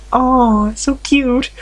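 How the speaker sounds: high, squealy gush over something cute; squealing with delight. Real, not acted. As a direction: melt at how adorable it is. Gushing over sweet game line.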